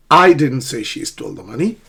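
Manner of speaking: I punched loud in "I didn't say", rising end; stress on I. Shown as a demonstration. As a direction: hit the opening "I", meaning someone else said it. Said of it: "not me".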